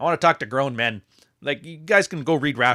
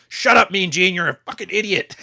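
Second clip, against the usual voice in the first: higher, louder mock wrestling commentator; Jesse Ventura; 6 semitones higher, 5 dB louder than usual. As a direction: bark it at the announcer. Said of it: "Jesse Ventura would be like".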